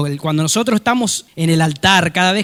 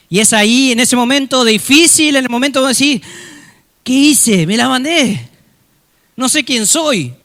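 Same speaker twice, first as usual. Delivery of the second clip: loud, raised preaching cry; impassioned preaching; 6 semitones higher, 6 dB louder than usual. Acted. Preacher voicing a believer's despair, loud and impassioned. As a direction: push it out to the whole congregation.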